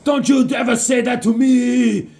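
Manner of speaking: loud, high mock-furious shout; angry retort. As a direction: roar it like an insulted comic-book bully. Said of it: "And he goes".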